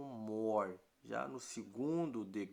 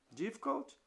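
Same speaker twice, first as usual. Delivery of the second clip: much higher, playful Difficult?; mock surprise; 12 semitones higher than usual. Teacher teasing students, high mock surprise. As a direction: tease them that it is easy.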